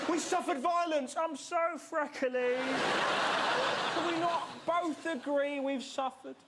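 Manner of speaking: high whiny moaning bloke. Retold or imitated. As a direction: whine about the sun, sluggishly. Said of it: "a ginger bloke behind and go".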